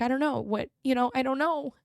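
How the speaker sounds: high-pitched, flustered at a loss; retold helplessness. Telling grandmother she has no idea what she needs, retold helplessness. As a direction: replay being overwhelmed, pitch well up.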